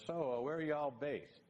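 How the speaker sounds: mock Texan interviewer, slow; Ross's quick question. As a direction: play the curious Texan boss. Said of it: "he does this little, quick little, he goes".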